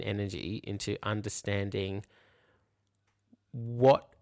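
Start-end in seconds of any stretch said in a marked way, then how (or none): none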